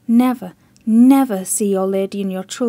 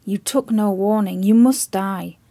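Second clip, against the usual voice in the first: grim warning; 6 dB louder than usual. Acted. Chips foretelling the admiral's death, loud and grim.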